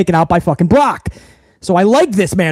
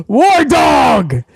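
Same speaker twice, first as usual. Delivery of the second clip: calling out a name; 5 semitones higher than usual. Real, not acted. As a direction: hail a regular in the chat.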